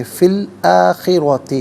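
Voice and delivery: فی الآخرۃ with a full heavy ra, slowly; heavy ra recitation. Shown as a demonstration. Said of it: "را کو پر کر کے پڑھیں گے".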